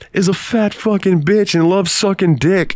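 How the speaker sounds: high, mocking call to the crowd; imitating a bully calling out to the schoolyard; mocking announcement. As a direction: announce it to everyone like a jeering kid. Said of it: "Sean comes out and he goes".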